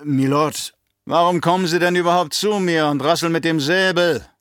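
loud, high-pitched tirade from the baron; bellowing, scornful outburst; angry bellow. A narrator's reading. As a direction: roar at an incompetent subordinate. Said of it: "brüllte der Baron".

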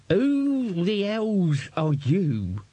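high, slow, outraged codger; old man confronting an intruder; indignant old man. Acted. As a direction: demand like an old man caught off guard.

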